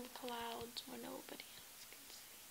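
dramatic hushed lament; airy melancholy whisper. Acted.

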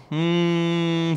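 normal talking voice

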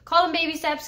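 ordinary delivery

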